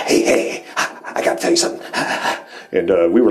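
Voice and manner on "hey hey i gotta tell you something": whispered, quick secret voice; talking dog. Retold or imitated. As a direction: hiss it out fast like a dog confiding. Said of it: "did you fucking hear that dog just talk to us".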